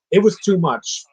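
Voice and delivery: normal talking voice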